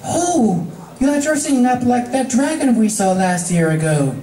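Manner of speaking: high voice dawning on it; Fluttershy, sudden recognition; Fluttershy realising. Acted. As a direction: remember suddenly.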